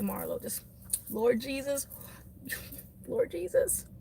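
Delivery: hushed mock prayer. Praying for patience after the pushy salesperson, hushed. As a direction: murmur a prayer for patience, half laughing. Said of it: "I have to pray and I'm like".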